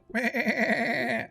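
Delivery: high bleating giggle; a whiny creature titter; creature cackle. Acted. GM voicing a goat creature.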